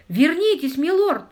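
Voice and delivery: high, loud cry of alarm; terrified shout. A narrator's reading. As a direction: shout in horror for him to come back. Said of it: "в ужасе закричал камердинер".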